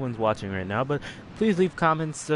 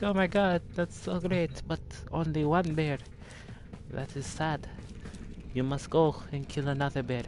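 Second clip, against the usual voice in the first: raised-pitch Russian grandma voice; lightly voiced babushka in a Russian accent; 4 semitones higher, 5 dB quieter than usual. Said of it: "I do the Russian accent".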